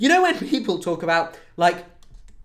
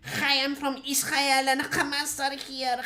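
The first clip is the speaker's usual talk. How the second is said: slow, higher mock Israeli accent; 7 semitones higher, 4 dB quieter, slower than usual. Mocking how Israelis sound, Israeli accent. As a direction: drawl it out in a put-on Israeli accent. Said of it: "that's the Israeli accent".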